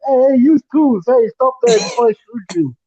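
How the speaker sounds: loud, high Italian cop voice; hammed-up Italian-American tough cop; Italian accent. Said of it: "would have a lot of Italian accent".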